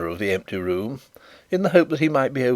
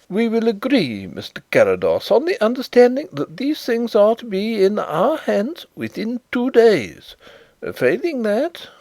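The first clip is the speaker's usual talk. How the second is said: higher, fretful manager voice; lightly raised manager voice; anxious bank manager; 10 semitones higher than usual. Acted. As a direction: plead to protect the firm.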